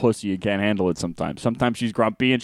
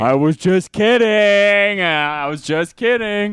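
high, drawn-out mock whine; hammed-up backpedalling; mock retraction; 9 semitones higher, 4 dB louder, slower than usual. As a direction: stretch the words out, high and loud. Voicing a reluctant retraction.

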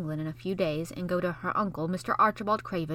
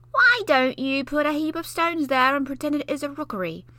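put-on higher, louder little-boy voice; boy's eager suggestion; 7 semitones higher, 6 dB louder than usual. Basil proposing a game to Mary, eager boy voice.

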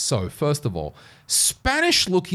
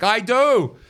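high, louder insistent reply; 9 semitones higher, 4 dB louder than usual. Retold or imitated. Answering her girlfriend's jab, a high insistent I do. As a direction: defend yourself, pitched up.

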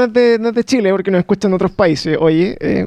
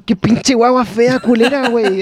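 slow, put-on Mexican accent; a clumsy Mexican accent imitation; lightly voiced Mexican slang; 2 semitones higher, slower than usual. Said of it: "nuestros amigos mexicanos".

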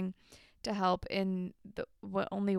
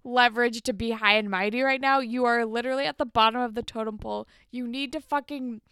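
louder lecturing scold; pretend telling-off; 2 semitones higher, 6 dB louder than usual. Telling Paige off in an imagined retort, louder scolding. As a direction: put her firmly in her place.

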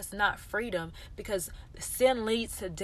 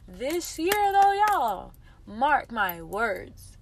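high, loud, drawn-out proclamation; emphatic declaration; 3 semitones higher, 7 dB louder, much slower than usual. Real, not acted. Telling listeners this birthday year is different, emphatic declaration. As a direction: proclaim it, stretching out the words.

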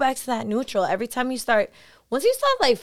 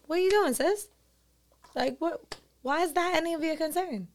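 sassy self check; 6 semitones higher than usual. Retold or imitated. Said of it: "I'm gonna be like".

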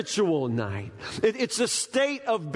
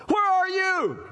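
high, louder startled question; startled wife; 12 semitones higher, 3 dB louder than usual. Retold or imitated. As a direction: play the wife jolting awake. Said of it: "she wakes up and she says".

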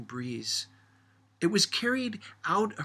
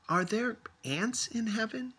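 put-on child's question; 8 semitones higher than usual. A narrator's reading. Child asks grandmother.

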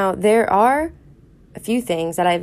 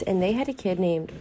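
ordinary talk, nothing marked

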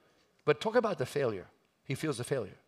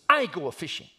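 loud, high burst of exasperation; frustrated outburst; 7 semitones higher, 11 dB louder than usual. Acted. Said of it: "out of his frustration".

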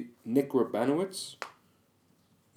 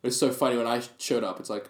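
normal talking voice

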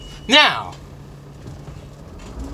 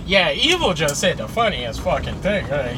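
ordinary delivery

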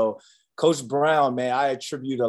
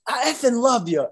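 much higher, exuberant declaration; joyful outburst; 11 semitones higher, 3 dB louder than usual. Retold or imitated. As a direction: burst out with love after the win. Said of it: "He goes".

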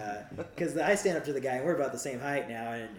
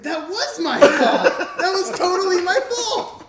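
high, loud, slow laughing admission; realising his fault a year later, laughing loud; laughing realisation; 15 dB louder, slower than usual. Retold or imitated. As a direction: laugh in horror at your own guilt. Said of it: "and I was like".

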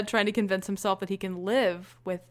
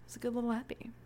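whispered aside; 11 dB quieter, faster than usual. Real, not acted.

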